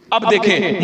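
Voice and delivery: usual speaking voice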